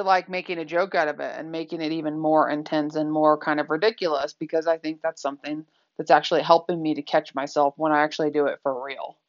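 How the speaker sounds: ordinary delivery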